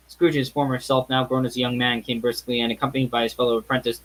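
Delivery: plain reading voice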